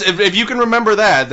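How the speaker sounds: normal talking voice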